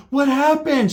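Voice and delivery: high, loud shocked question; mock a startled shriek of surprise; alarmed exclamation. Cousin greeting the bleeding narrator, alarmed exclamation.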